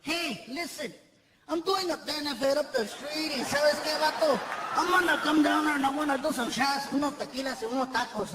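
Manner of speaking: a hint of laid-back Mexican-American accent; imitating Paul Rodriguez. Idol calling the club to invite him out, light Chicano accent. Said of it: "He calls up the comic strip and he says".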